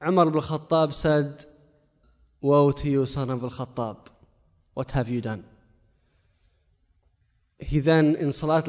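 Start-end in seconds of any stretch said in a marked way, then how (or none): 2.4-5.4 quiet, broken self-reproach; remorseful lament. Retold or imitated. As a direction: hush down as if the guilt hits you. Caliph grieves his own mistake.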